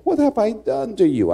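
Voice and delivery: high, wounded put-on voice; mock an innocent boy protesting; high-pitched imitation of a bewildered victim; pleading classmate.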